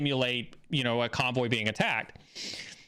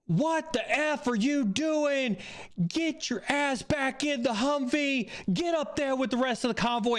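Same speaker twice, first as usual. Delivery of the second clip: retold yelling; 9 semitones higher than usual. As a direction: bark it out like an airman chewing someone out. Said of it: "I am yelling".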